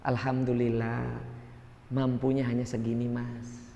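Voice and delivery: quiet humble alhamdulillah reply; humble grateful reply. Retold or imitated. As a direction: deflect the jab gently with gratitude. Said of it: "Apa kita jawab kalau gitu".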